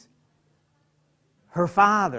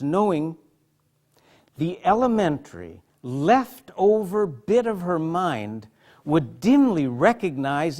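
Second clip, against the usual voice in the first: poem reading; 4 dB louder than usual. Acted. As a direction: let each line weigh as you read. Reads a poem aloud.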